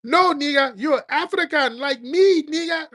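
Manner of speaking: high, loud caricature voice; shrill mock voice, slowed down; mock African man. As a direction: lampoon the other side insisting. Mocking an African man's claim.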